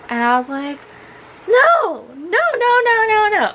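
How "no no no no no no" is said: high, loud string of refusals; hammed-up terrified protest; mock freak-out. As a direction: re-enact panicked refusing, pitched way up. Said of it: "I was just freaking out".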